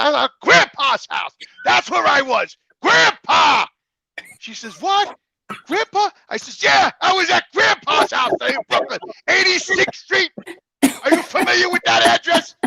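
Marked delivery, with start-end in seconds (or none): 0.0-3.7 high, loud accusing yell; retold shout. Son confronts mother about grandpa, yelling. 4.8-6.1 high, loud stunned squeak; shocked mother. Retold or imitated. Mother hears son found grandpa, shocked cry. 6.6-12.6 high, loud furious shout; retold yelling. Son confronts mother with grandpa's address, shouting.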